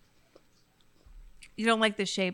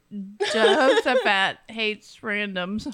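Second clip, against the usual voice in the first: slow and laughing; 4 semitones higher, 5 dB louder, much slower than usual. Real, not acted. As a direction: laugh through the words slowly.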